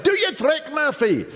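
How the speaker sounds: very high shouted command; shouting. Retold or imitated. The whole pub shouts at Murphy to do his trick. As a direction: call out for the trick. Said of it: "they all shouted".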